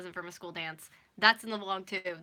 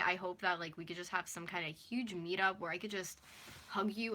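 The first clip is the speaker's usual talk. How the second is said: usual speaking voice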